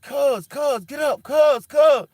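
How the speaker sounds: cuz and get up yelled high and very loud; frantic shouting. Retold or imitated. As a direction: scream at him to wake up. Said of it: "I was screaming at him".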